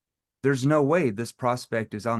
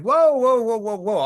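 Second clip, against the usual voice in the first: high, loud playful whoas; playful teasing whoa; 10 semitones higher, 6 dB louder than usual. Shown as a demonstration. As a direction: tease with bright high whoas. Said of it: "why were you using a playful tone".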